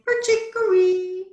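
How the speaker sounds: high, loud, held bird call; bird song. A narrator's reading. Bird answering the whistle, high singing call. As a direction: sing it high and stretch the last syllable. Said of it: "Somewhere a bird sang".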